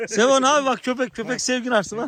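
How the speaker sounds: high-pitched, projected encouragement; loud urging. Real, not acted. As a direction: call out to coax someone closer. Urging villager to pet dog. (tiz, yüksek sesle teşvik)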